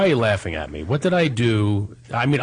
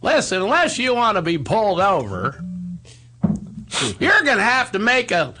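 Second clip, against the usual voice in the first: Barney Fife impression; 10 semitones higher than usual. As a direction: warn the driver like nervous Barney Fife. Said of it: "like Don Knotts, Barney Fife".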